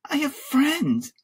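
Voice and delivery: put-on high, pleading boy's protest; crying out. A narrator's reading. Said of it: "I cried".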